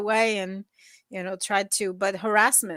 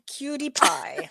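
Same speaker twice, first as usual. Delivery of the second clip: slow, higher-pitched pet talk; cooing at dog; 4 semitones higher, 4 dB quieter, slower than usual. Real, not acted. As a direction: coo softly to a beloved dog, drawn out.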